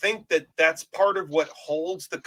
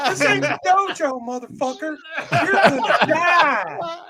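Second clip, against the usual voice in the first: even higher mock threat, laughing through it; higher Max DeVille impression; 12 semitones higher, 4 dB louder than usual. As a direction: push it higher still, cracking up on the end. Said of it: "Still a little high, higher in register".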